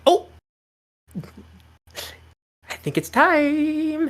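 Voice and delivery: high, slow fey voice; drawn-out airy fairy host; high fey lady. Acted. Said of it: "and she goes".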